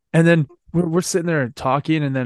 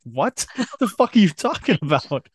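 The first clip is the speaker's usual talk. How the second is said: high-pitched mock disbelief with a laugh; replayed confusion, pitched up; retold bafflement; 6 semitones higher than usual. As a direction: laugh through a baffled question. Retold baffled reaction to news.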